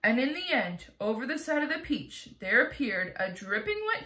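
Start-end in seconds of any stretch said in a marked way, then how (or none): none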